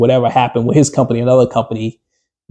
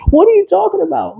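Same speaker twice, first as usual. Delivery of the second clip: high, incredulous question; high-pitched baffled question. Retold or imitated. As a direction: act floored by the rumor. Acted confused reaction.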